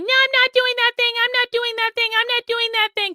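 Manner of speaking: high-pitched, quick mock whine; whining. Streamer imitating chatters who deny breaking rules, exaggerated whining. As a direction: whine it on repeat like a protesting kid. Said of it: "I do not want you whining and saying".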